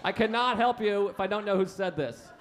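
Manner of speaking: high-pitched mock indignation; indignant farmer. Farmer in front of all his animals, indignant demand. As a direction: play a farmer interrogating the livestock. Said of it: "a farmer's in front of all of his animals".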